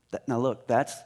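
ordinary delivery

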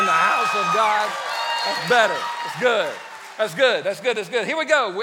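loud, rousing praise to the crowd; preacher shouting approval; hyped shouting. Real, not acted. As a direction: pump the room up. Pastor hyping up the congregation.

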